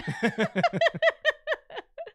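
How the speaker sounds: loud high-pitched laugh. Real, not acted. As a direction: burst into a high giggle. Co-host laughs at own hype.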